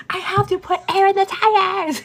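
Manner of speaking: high, loud indignant protest; hammed-up exasperation, close to laughing; retold bickering. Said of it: "getting real ugly".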